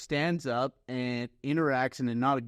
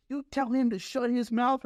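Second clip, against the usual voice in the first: much higher, gruff mock order; imitating a stern coach; 10 semitones higher than usual. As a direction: bark the coach's order at the scorer. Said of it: "he looks at me and goes".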